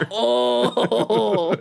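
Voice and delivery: drawn-out villainous oh into a cackle; evil person. Acted. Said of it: "That's how I imagine evil people".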